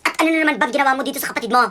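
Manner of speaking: loud, fast telling-off; cross mother, loud and rapid; angry scolding. Acted. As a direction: scold the older child in a rush. Mother scolding older son.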